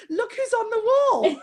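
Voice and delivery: an eager husband exclaiming at the wall; husband calling out. Retold or imitated. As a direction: play the husband proudly calling her over. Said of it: "My husband came back and said".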